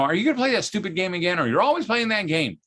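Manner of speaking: high, fast mock nag; nagging wife. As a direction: tease the gamer husband. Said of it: "if your wife says to you".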